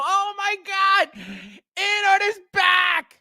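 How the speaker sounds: high, drawn-out mock fan shout; hammed-up hysterical fan excitement; mock losing their minds. Streamer imitating shills over in-art news, mock frenzy. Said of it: "You're gonna see the shills lose their minds over it".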